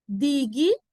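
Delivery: "Dignidade" as di-gui, ni, da, de, long gaps; syllable by syllable. Shown as a demonstration. Teacher separating the word for students, syllable by syllable. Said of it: "como é que eu separo essa".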